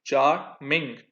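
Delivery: चार्मिंग said as char-ming; चार्मिंग lightly split into syllables. Shown as a demonstration. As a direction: mark the two syllables of चार्मिंग. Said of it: "दो syllables हैं".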